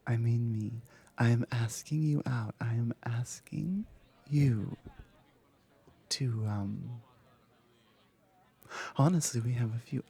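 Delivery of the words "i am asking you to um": whispered repeat. Acted.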